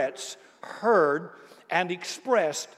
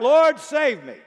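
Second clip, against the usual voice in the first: high, loud desperate plea; cry for help; 8 semitones higher, 7 dB louder, slower than usual. Acted. Higher, louder frightened cry. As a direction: Peter sinking, crying out to the Lord for rescue. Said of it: "cried out".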